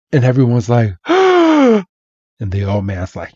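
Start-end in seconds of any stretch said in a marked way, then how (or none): 1.0-1.0 sharp shocked gasp; high gasp. Retold or imitated. Everyone gasps.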